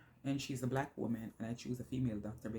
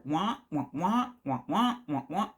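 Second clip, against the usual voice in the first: mock droning wah wah like a teacher in a cartoon, louder; louder droning wah wah for voices she could no longer follow; muffled adult wah-wah; 4 semitones higher, 7 dB louder, slower than usual. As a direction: imitate muffled talk she tuned out. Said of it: "I only hear".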